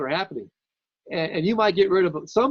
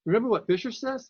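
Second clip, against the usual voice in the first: normal talking voice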